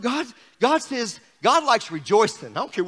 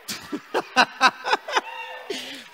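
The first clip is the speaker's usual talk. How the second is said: laugh; 5 dB quieter than usual. Real, not acted. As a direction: laugh it out in a quick run. Preacher laughing with the congregation.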